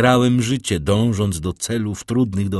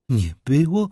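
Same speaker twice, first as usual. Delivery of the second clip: frightened repeat; 6 semitones higher, 3 dB louder than usual. A narrator's reading. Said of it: "powtórzyła raz jeszcze".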